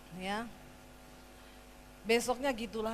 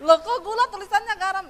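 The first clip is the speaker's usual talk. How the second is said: surprised; 9 semitones higher, 14 dB louder than usual. Retold or imitated. Husband puzzled by labelled jar.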